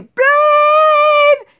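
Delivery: high loud panicked call for husband; panicked shout. Retold or imitated. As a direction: scream his name in alarm. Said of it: "That's all I ever do".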